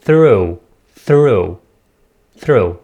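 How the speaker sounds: "Through" with the tongue out on th. Shown as a demonstration. Teacher showing where the tongue goes, tongue-out th. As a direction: repeat "Through" singly, a clear th at the front. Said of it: "نخرج، آه، لساننا بعض الشيء".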